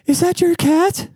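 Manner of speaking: high-pitched falsetto lady; mock lady's falsetto question; neighbor lady impression voice. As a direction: imitate the neighbor in a squeaky-high put-on voice. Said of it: "I hear this lady go".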